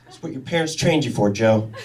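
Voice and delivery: normal talking voice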